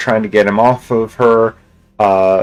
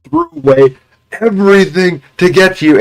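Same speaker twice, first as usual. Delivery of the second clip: rising angry demand, climbing much higher and louder at the end; desperate father losing it at the girl; retold snapping father; 4 semitones higher, 3 dB louder than usual. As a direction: imitate a father who has just snapped.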